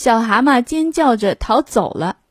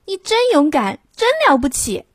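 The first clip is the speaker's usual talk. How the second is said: high, excited little toad; high-pitched youngster's admiration; admiring cry; 8 semitones higher than usual. A narrator's reading. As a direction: gush high with praise. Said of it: "他叫道".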